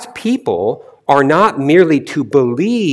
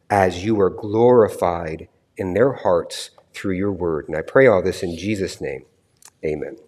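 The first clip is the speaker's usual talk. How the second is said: prayer; 3 semitones lower than usual. Real, not acted. Leads a prayer.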